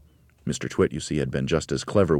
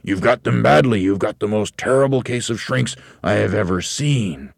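raised, emphatic, loud husband; loud alarmist verdict; 5 semitones higher, 6 dB louder than usual. A narrator's reading. Husband frightening his wife about shrinks, loud emphatic voice. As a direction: hammer home the dreadful diagnosis.

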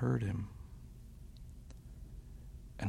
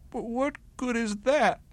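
high, louder bunny voice, sniffly; a hurt little protest; sniffling bunny; 14 semitones higher, 6 dB louder than usual. A narrator's reading. Bunny doubting the wolf's words, high and sniffly. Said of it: "Barrington sniffed".

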